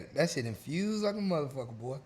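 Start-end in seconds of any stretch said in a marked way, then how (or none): none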